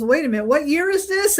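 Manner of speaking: imitating a puzzled questioner, pitch climbing high; mock-baffled question, voice shooting up at the end; retold surprise. Retold hypnotist taken aback.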